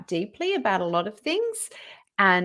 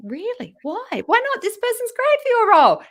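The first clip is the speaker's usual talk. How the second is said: rising incredulous pleading, getting louder; exasperated; 14 semitones higher, 6 dB louder than usual. Retold or imitated. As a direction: push back in disbelief, building up.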